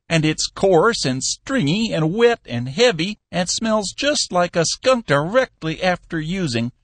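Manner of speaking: an old boss savouring bad news; old Cappy. A narrator's reading. Cappy's voice in a read story.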